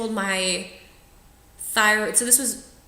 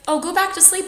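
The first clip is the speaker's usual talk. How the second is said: soothing surgeon; 11 semitones higher, 5 dB louder than usual. Retold or imitated.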